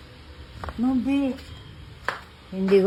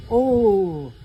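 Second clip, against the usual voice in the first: usual speaking voice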